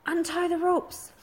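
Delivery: high, slow, bossy order; ordering Snotlout. A narrator's reading. Snotlout orders the boys at the cave, a high bossy command. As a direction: bark the command at the gang. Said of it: "ordered, ordered Snotlout".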